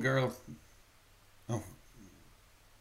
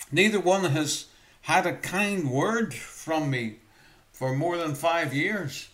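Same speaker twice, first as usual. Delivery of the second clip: loud, high, driving sermon delivery; raised, urgent preacher's voice; preaching at full force; 5 semitones higher, 6 dB louder than usual. Acted. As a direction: preach it to the back pews.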